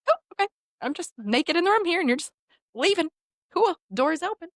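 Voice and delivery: high, awkward retold acceptance; mock-nonchalant disbelief. Client left naked on table, high mock-casual. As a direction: pitch it up, feigning that it's fine.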